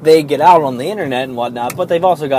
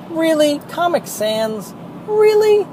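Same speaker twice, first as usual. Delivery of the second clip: incredulous; slower than usual. Real, not acted. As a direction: scoff at it in disbelief. Ranting at a truck's font.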